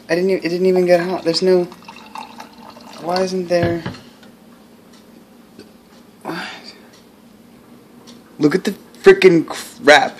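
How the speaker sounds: ordinary delivery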